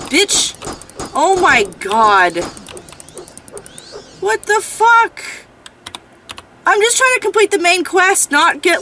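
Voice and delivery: high, loud exasperated cursing; real frustrated yelling, words stretched out; mad outburst. Real, not acted. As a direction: rage at the game in disbelief. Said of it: "I'm so mad right now".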